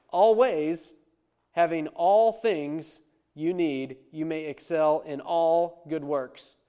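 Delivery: slow, loud reading leaning on all; slow reading that hammers "all"; stressing all. Shown as a demonstration. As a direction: lean hard on every "all". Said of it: "I'll read it how it goes in the Greek".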